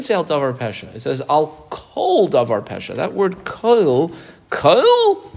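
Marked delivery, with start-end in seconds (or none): 3.4-5.3 Kol stretched out, high and loud; emphatic Kol. Shown as a demonstration. Teacher singling out one word for students, emphatic Kol. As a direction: set Kol apart, hit it higher the second time. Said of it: "in quotation marks".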